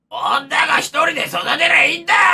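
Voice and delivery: loud, blustering rant in a put-on voice; mock brash streamer. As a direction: bark it like a macho loudmouth. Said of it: "うなちゃんマンは". (乱暴な配信者のまね)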